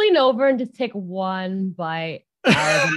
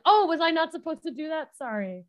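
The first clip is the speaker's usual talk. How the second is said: innocent apology; 7 semitones higher, 3 dB quieter, faster than usual. Acted. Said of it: "she's like".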